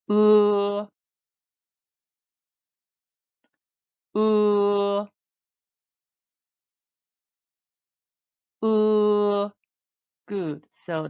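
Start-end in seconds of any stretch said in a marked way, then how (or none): 0.1-9.5 long level "Uh" higher and louder; held "uh". Shown as a demonstration. Teacher models held "Uh" sound for students to repeat. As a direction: hold "Uh" out steady. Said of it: "Read after me".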